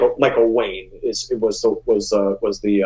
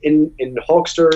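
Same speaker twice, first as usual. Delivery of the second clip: usual speaking voice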